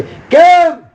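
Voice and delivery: a loud, high-pitched cry of "કેમ?"; shouted why. Acted. Preacher urging the congregation to cry out why, shouting. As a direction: yell the question up at heaven. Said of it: "એવું બૂમ પાડી દે".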